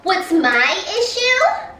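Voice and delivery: high, slow, indignant echo; incredulous coworker repeating it back in a high voice; mocking coworker. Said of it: "And she said".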